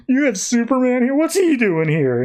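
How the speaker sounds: high, startled question, slowing on the second line; a startled son, voice jumping up; mock alarmed surprise. Son startled to find Superman at his parents' house, high and alarmed.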